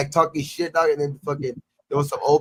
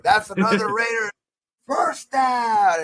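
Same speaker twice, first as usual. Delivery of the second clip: much higher and louder, bellowing it out; mock announcer shout; 10 semitones higher, 5 dB louder, slower than usual. Taunting Steelers fans with his own first-down call, shouted. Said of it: "I would do it myself".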